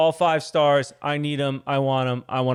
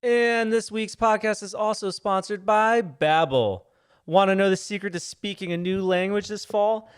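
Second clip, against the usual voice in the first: bright, higher sponsor read; 5 semitones higher, slower than usual. Acted. Husband reads the Babbel ad to listeners, bright and higher. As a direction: pitch Babbel like an eager host.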